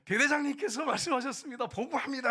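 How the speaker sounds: high, loud soldier's report; military report. Retold or imitated. Soldier citing the battalion commander to sergeant, loud high reporting. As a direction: call it out to a superior officer.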